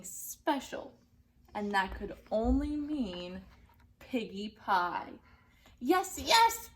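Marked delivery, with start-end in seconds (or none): 5.8-6.7 higher, louder gleeful witch crying yes yes; a hungry witch who can't wait; high, loud greedy witch cheer; eager witch voice. Acted. Gritch the witch voiced in story.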